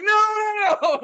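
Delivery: high, loud mock wail; panicked crying out. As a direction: cry out to escape the fumes. Reenacts fleeing the fumes.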